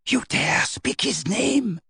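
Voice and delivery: slow, hushed Bellatrix menace; a slow threatening hiss of disbelief; slow menacing whisper of a witch. A narrator's reading. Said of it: "whispered Bellatrix".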